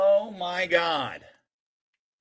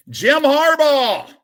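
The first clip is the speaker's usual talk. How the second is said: the name shouted higher and louder, slowed; excited call; 10 semitones higher, 9 dB louder, slower than usual. Real, not acted. Opening packs on camera, shouts the name excitedly. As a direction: belt the name out like a big pull. Pulls a card, calls it.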